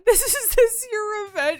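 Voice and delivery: high, loud, laughing imitation of a teary accusation; mock tearful. Re-enacting partner's revenge accusation, mock tearful. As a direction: wail it as a hurt partner, amused. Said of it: "tearing up and crying".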